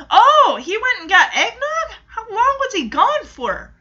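high, loud laughing question; laughing disbelief. Real, not acted. Vlogger laughing at the show's eggnog scene, amused disbelief.

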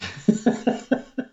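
put-on high breathless old man's cackle; wheezy cackle of an old prospector; old geezer cackle. Old-timer bit continues.

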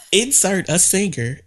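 laughing through the words. Real, not acted. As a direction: read it out while cracking up. Mocking the magnet word game.

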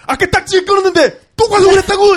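high, loud incredulous challenge; indignant mock scolding. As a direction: shout it in imitated disbelief. Cameraman or officer berating him, acted. (어이없다는 듯 버럭 따지는 흉내)

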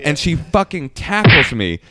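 ordinary talk, nothing marked

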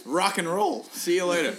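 high, loud mock farewell; loud breezy send-off. Friends abandoning the truck after the toss, loud send-off. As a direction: call it out and be gone.

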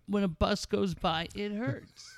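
high, whiny mock victim, fading on hurts; mocking whiny complaint. Mocking Stossel's deafness whining to Barbara Walters. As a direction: whimper it like a wounded crybaby.

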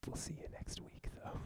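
soft unvoiced whisper; whispered aside. Real, not acted. Co-host to listeners, quiet whispered aside. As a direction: slip it in under your breath.

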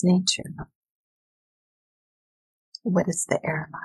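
calm, unhurried visualization voice; guided meditation. Acted. Guided visualization read aloud.

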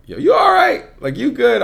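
high-pitched voicing of checking-in friends; imitated high, questioning concern. Friends checking on the speaker, voiced.

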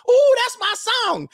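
put-on very high, slow squeal of a girl hearing her song; girly squeal. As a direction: stretch it out, high and thrilled like a girl at a party.

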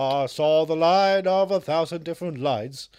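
hammed-up biopic hero line; dramatic movie voice. Mock [name] movie voice.

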